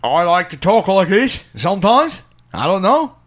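hammed-up English voice; English accent. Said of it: "you don't like my English accent".

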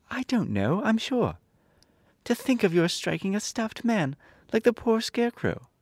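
put-on high girl's voice, scolding; a child chiding the lion; little girl reproaching; reproachful. A narrator's reading. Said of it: "who was still angry".

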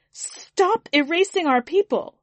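high, loud protest yell; angry shout. Acted. Another mourner shouts at the alien, angry yell. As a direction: hurl it across the aisle. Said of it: "Another shout came".

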